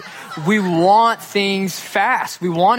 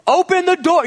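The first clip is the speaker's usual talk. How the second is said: high, loud yell at the door; bang-on-the-door shout; mock yell at a friend who won't answer; shouted demand; 13 semitones higher, 5 dB louder than usual. Knocking, frustrated at friend's door.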